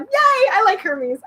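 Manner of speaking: slow, high little cheer. Real, not acted. Reader breaks off the book to cheer for Hermes. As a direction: cheer for your favourite god.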